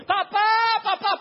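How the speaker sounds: grito agudo, fuerte y lento; grito de niño. Acted. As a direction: estira cada papá con desesperación. Isaac llama a su padre. (Isaac en el altar llama a su padre, gritando lento)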